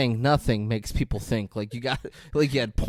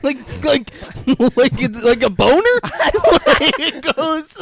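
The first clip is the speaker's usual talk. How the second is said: high, laughing and excited; giddy laughing rant; 10 semitones higher than usual. Real, not acted. Giggling to his cohost, high excited laughing. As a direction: squeal through the joke. Laughing while describing Pooh.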